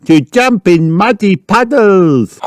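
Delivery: put-on high, drawn-out little-girl cheer; high storybook piglet exclaiming; excited cry. A narrator's reading. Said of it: "cried Peppa".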